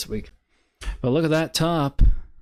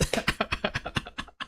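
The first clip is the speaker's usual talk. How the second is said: laughing; 10 semitones higher, 9 dB quieter than usual. Real, not acted. Laughing at chart.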